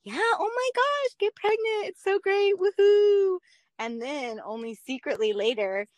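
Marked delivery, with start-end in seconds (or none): 0.1-3.4 high, sing-song fake enthusiasm ending in a loud woohoo; parodying friends hyping pregnancy, mock cheer; mock cheerleading.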